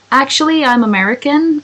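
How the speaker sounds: high-pitched, badly faked American accent. Streamer pretending to be American for chat, bad American accent. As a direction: put on a hammy, unconvincing American accent. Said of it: "That's a really bad American accent".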